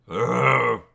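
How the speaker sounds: loud growling roar from the throat; a loud animal roar of alarm; Wookiee roar. A narrator's reading. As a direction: roar like Chewbacca, loud and raw. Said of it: "Chewbacca roars".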